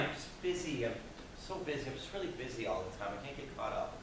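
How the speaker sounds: soft, drained mumbling, trailing into a whisper; mock exhausted muttering under the breath; tired mumble. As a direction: sigh it out like you're worn down. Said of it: "You ever find yourself saying things like this".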